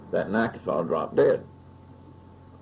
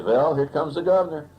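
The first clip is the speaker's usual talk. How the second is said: imitating the uncle's accent, much higher and louder, "Vell, here comes the governor"; mock the uncle's accent with a teasing grin; 10 semitones higher, 8 dB louder than usual. Uncle teasing the boy at family coffee, in his accent. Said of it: "I had an uncle who spoke with an accent".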